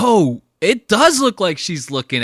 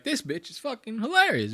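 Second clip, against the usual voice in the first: mock Texan crowd; 10 semitones higher, 5 dB quieter than usual. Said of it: "You know how they talk down there in Texas".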